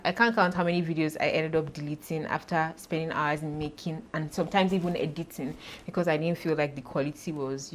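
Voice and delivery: ordinary talk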